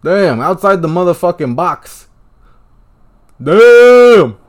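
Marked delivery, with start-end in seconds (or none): 3.4-4.4 long, loud, high cry of awe; amazed shout. Real, not acted. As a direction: let the word hang in disbelief. Reacting to a replayed goal.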